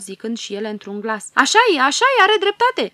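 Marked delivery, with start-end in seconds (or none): 1.3-2.9 much higher, louder squealing agreement; shrill up as a crowd of fairies cheering; noisy fairy chorus. A narrator's reading. Fairies backing the tiger fairy, much higher and louder clamour. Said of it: "o aprobară zgomotos".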